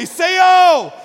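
very loud, high sudden shout; rousing shout. Shown as a demonstration. Preacher showing congregation how to wake their neighbor, rousing shout. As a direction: jolt a sleeper awake with it.

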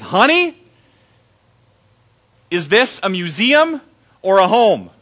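lightly voiced wife setting him straight; pointed question. Wife confronting husband about the house, pointed and knowing. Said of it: "she looks me right in the eyes and she says".

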